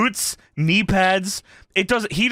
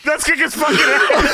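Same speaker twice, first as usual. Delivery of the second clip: higher, rallying mock threat; rally the gang in jest; mock bully; 7 semitones higher, 4 dB louder than usual. Podcaster playing school bully rounding up friends, mock threat.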